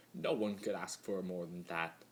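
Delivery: ordinary delivery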